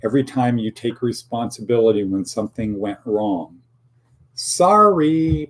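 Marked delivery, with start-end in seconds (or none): 4.3-5.5 high, loud, drawn-out mock sorry; insincere sorry. Shown as a demonstration. Host showing the wrong way to apologise, insincere mock sorry. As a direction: sing out a badly faked apology. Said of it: "you don't say it like that".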